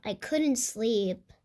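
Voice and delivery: plain reading voice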